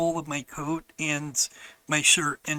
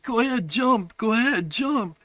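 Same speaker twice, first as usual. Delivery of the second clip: higher, lightly mimicked sister urging; egging on; 6 semitones higher than usual. Sister urging brother onto the ice, mock egging on. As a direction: lightly imitate a child coaxing someone on.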